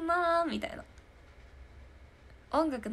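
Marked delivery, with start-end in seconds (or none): 0.1-0.8 a nasal "なー" pitched higher; Kano impression. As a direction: ring a nasal "なー" up high like Kano. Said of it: "モノマネ". (かのさんの物真似で高めの鼻声「なー」)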